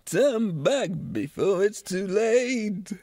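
hammed-up slow, swooping warning; Goblin King. Goblin King warns Sarah off, theatrical croon. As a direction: project it grandly, stretching the end. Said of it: "doesn't he say".

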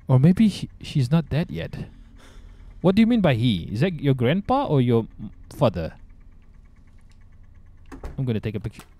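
normal talking voice

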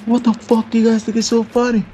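high, squeaky mock scolding; hammed-up outraged leader; mock angry teacher. Imitating the AA teacher scolding the laughing friends, high and squeaky. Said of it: "the A A teacher stands up and he's like".

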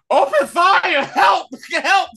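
high, loud cries for help; mock panicked shout for help; panicked yelling trooper. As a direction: yell for help like a panicking soldier. Empire troops panicking, retold.

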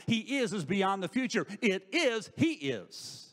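loud, high-pitched, driving sermon delivery; impassioned preaching. Real, not acted. Preacher urging believers to trust that God is, impassioned. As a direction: preach it with rising force, hitting key words.

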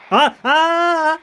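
long high loud wail; anguished cry. Real, not acted. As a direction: wail at the loss. Losing in game.

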